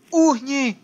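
loud high shout at the enemy; panicked yell. Real, not acted. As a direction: scream it mid-fight. Fight, dodging enemy. (panický výkřik)

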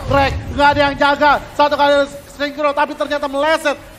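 loud, high hype call; excited shout. Real, not acted. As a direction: yell the play with rising hype. Caster hyping a kill.

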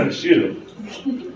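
usual speaking voice

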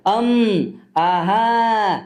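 आहा drawn out as a lazy wrong sound; mock wrong pronunciation. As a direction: imitate pupils saying आहा. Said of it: "तसा उच्चार करायचा नाही".

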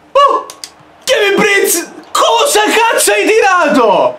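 high outraged yell; outraged shout. Real, not acted. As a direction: shout in disbelief. Outraged at a bad shot.

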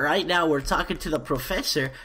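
normal talking voice

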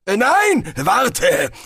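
flustered wizard keeping Death from leaving; hasty. A narrator's reading. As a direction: rush to hold him back. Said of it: "erwiderte Galder hastig".

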